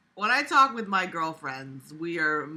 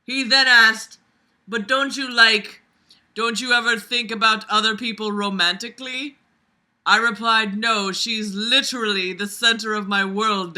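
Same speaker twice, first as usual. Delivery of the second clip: loud, raised read-aloud voice; story narration; 4 semitones higher, 9 dB louder than usual. A narrator's reading. Reader voicing two friends' talk aloud, projected narration. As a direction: act out the dialogue with big projection.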